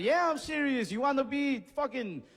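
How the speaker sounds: high-pitched, hammed-up enthusiasm; acted high-energy hype; hyped-up insistence. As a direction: egg the salesman on, pitched well above normal. Acted hyped-up pep talk to seller.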